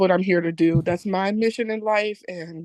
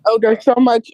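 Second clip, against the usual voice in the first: louder after being told muffled; louder repeat; 2 semitones higher, 6 dB louder than usual. Real, not acted. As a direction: speak up clearly. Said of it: "You're muffled again".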